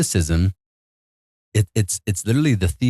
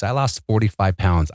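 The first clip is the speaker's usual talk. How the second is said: ordinary talk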